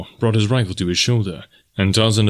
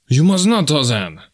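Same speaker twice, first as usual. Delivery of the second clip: high, loud protest, falling on the name; urgent cry; 5 semitones higher, 4 dB louder than usual. A narrator's reading. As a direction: cry out to stop him. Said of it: "cried Deonot".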